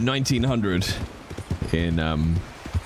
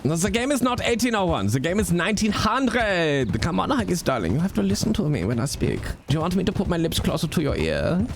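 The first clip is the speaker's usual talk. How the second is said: put-on German accent; 5 semitones higher than usual. Franz correcting a player.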